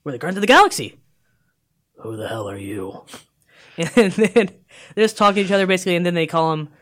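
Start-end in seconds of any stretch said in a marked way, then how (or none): 0.0-1.0 higher, louder announcement; emphatic repeat. Retold or imitated. Crew repeating their name to a baffled stranger, raised and emphatic. 2.0-3.0 low, soft, deep-voiced question; mock deep hero. Rescued man questioning the strangers, low and deep. Thor meets the Guardians, acted.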